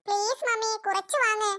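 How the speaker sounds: put-on high child voice begging, a bit slow; pleading little kid. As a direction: coax mummy like a small child. Kids pleading again.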